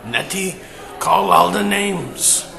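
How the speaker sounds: put-on slow shouted German-accented plea; heavy German accent. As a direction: Uncle Harry phoning his wife from jail in a German accent. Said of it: "he had a heavy German accent".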